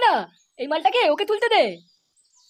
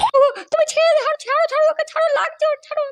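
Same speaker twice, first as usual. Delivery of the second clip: pleading mother; 11 semitones higher than usual. Acted. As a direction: play the mother crying out to spare her son. Mother begs father to stop. (ছেলেকে বাঁচাতে মায়ের উঁচু গলায় ধীর কাতর অনুনয়)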